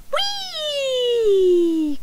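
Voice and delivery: high, long sliding whee; gleeful whee. A narrator's reading. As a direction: ride the word down the slope. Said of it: "cried Euston as he slid".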